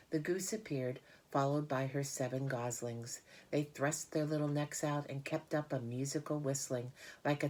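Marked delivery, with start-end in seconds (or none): none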